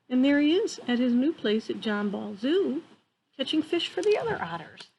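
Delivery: usual speaking voice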